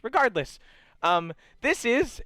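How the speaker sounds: brisk, moving on; guide voice. Acted. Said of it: "he goes".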